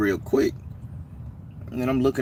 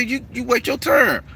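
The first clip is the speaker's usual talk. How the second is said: higher, retold stern order; angry order; 4 dB louder than usual. The Baron ordering the stranger to wait, angry. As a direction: put him in his place. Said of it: "I say".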